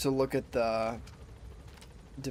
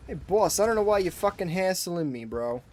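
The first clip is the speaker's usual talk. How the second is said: higher-pitched, badly faked New York accent; terrible New York accent; 6 semitones higher than usual. New Yorker complaining to boss about hassling, in a bad New York accent. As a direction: gripe like a hammed-up New Yorker. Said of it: "my New York accent is fucking terrible".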